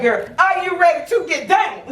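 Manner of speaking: high teasing retold holler; mock yell. Mother re-enacting her taunt in delivery room, playful mock yell. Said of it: "So I started yelling at her".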